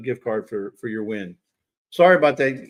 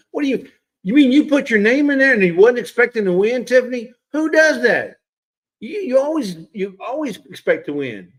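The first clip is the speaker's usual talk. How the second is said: higher, louder disbelief; how could you say that, teasingly; incredulous outburst; 9 semitones higher, 6 dB louder than usual. Real, not acted. Host teasing Tiffany who didn't expect to win, loud disbelief. Scolds winner for low expectations.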